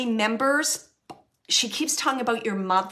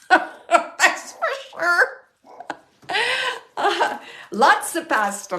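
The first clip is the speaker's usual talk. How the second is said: laughing through the words; amused laugh about the pasta; 7 semitones higher, 5 dB louder, much slower than usual. Real, not acted. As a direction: giggle it out.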